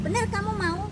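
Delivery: loud, high, pressing question; insistent checking. Retold or imitated. Narrator asks the woman to confirm her decision, insistent. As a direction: press hard to make sure. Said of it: "nanya tiga kali untuk meyakinkan".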